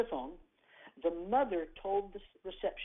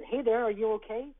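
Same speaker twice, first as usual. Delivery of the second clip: put-on higher, louder call to a lost child; gentle concern; 5 semitones higher, 6 dB louder than usual. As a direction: reach out gently but clearly. Stranger calling to lost boy.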